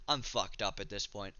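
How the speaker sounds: ordinary talk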